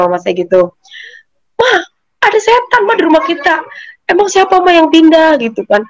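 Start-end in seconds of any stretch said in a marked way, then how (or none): 1.6-3.6 high, urgent frightened pleading; panicked plea. Retold or imitated. Insisting to mom about ghost. 4.0-5.4 anxious question. Retold or imitated. Asking mom who moved in.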